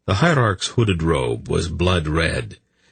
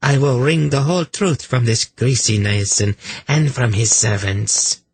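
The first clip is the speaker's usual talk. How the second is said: threatening zeal; 7 semitones higher than usual. A narrator's reading. Inquisitor or priestess threatening accused.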